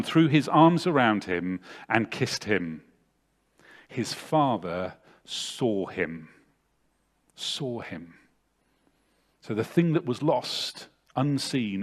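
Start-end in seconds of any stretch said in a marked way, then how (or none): 3.9-6.3 slow, softer, lingering on father; slow, hushed emphasis. Shown as a demonstration. Preacher lingers on the father's seeing, slow and softer. 7.3-8.1 much softer, falling echo of Saw him; near-whispered echo. Shown as a demonstration. Preacher echoes the moment to the congregation, hushed.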